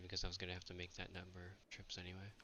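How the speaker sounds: ordinary talk, nothing marked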